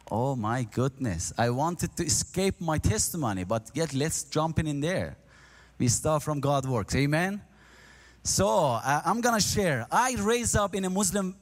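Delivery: ordinary talk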